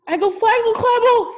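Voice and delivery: put-on very high, loud toddler gibberish; baby babble. A narrator's reading. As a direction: squeal it like a frantic baby. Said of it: "said Trixie again".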